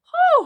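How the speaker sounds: high, loud sigh of relief; relieved whew. Acted. Teacher sighs in relief as they land safely. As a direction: let the relief burst out. Relief after safe landing.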